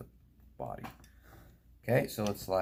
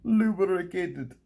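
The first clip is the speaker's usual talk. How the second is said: silly high drawn-out word; 9 semitones higher, 6 dB louder, much slower than usual. Pretend. Playful single-word exclamation.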